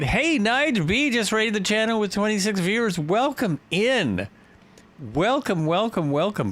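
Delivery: high, cheery welcome; excited welcome. Real, not acted. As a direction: greet the raiders with a big cheer.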